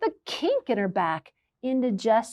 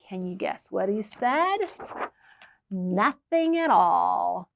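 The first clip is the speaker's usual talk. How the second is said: ordinary delivery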